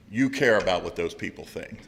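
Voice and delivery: normal talking voice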